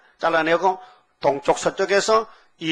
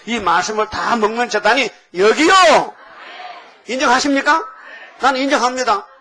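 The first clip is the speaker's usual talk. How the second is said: higher, louder rallying cry; preaching at full force; 5 semitones higher, 3 dB louder than usual. Real, not acted. Pastor loudly challenges the congregation to affirm the word. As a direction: demand the congregation's assent.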